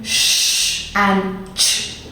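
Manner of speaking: long soft "sh", then short harsh "ch"; sh versus ch contrast. Shown as a demonstration. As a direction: draw out "sh" and cut "ch" short and hard. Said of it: "a lot more harsh and shorter".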